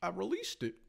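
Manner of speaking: high-pitched, halting confessor impression; mock confession. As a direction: imitate a man haltingly confessing. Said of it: "He was like".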